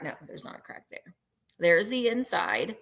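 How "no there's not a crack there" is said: muttered under breath. Real, not acted. Checking vase, muttered to self.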